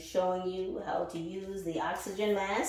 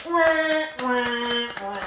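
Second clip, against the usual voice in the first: mock crying wah; 3 semitones higher, 5 dB louder, much slower than usual. Mocking the sad Aquarius with a fake wail. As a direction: tease them with fake crying.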